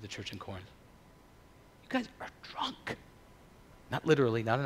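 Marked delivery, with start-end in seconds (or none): 1.8-3.0 intense stage whisper; hushed confrontation. Acted. Paul confronting the church in Corinth, hushed accusation. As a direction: whisper the accusation with pointed force.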